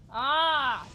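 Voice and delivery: ordinary talk, nothing marked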